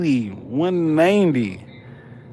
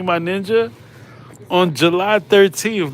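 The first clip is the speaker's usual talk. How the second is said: normal talking voice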